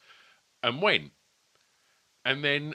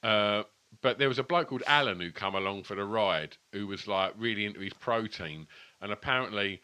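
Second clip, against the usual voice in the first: ordinary talk, nothing marked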